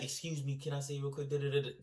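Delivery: polite quote. Retold or imitated. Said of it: "She might be like".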